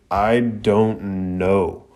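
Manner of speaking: "I don't know" drawn out slowly, word by word; "I don't know" in very slow, deliberate emphasis; emphatic. Retold or imitated. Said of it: "Dosu responds emphatically".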